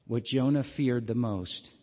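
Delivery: ordinary delivery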